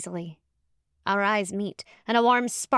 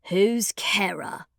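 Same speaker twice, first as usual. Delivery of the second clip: slow whispered menace; a stranger's slow hiss from the dark; grating stranger; much slower than usual. Acted. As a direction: rasp it out from the shadows. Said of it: "asks a grating voice".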